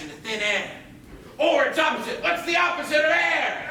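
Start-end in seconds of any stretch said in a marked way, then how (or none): 1.4-3.7 shouting. Acted. Pirates shout answers.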